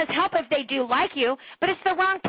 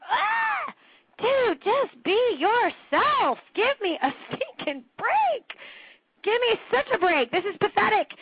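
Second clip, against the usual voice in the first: much higher, slow, drawn-out "dude, just be yourself"; exasperated outburst; 9 semitones higher, slower than usual. Retold or imitated. Coach retelling his exasperated reaction to his friend's pitch. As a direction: plead in disbelief at him. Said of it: "I'm like".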